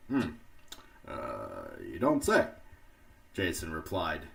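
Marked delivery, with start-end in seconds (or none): 0.1-2.5 sarcastic. Acted. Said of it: "being sarcastic".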